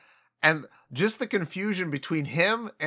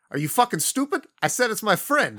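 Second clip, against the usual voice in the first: mock indignant snap; 6 semitones higher than usual. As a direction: act out the insulted scoff with a laugh. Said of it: "he's like".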